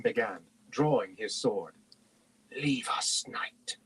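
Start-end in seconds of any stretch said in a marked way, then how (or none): 2.5-3.8 voiceless, sinister hush; soft whisper. A narrator's reading. Mage dismissing the knight, whispering softly. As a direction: whisper it from the shadows. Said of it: "Raistlin whispered softly from the shadows".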